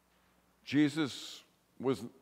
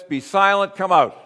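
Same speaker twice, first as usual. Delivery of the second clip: louder, higher retold command; forceful command; 8 semitones higher, 10 dB louder than usual. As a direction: imitate a firm, loud order to a demon. Voices Jesus commanding a demon.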